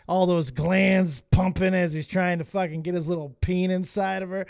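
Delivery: ordinary talk, nothing marked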